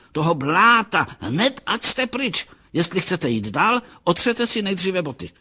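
high-pitched indignant housewife; scolding cry. A narrator's reading. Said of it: "křičela". (rozhořčené hubování vysokým hlasem)

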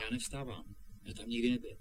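very soft, subdued protest; hushed denial. Acted. Boy assures his friend he's no ghost, hushed. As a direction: deny it gently and quietly.

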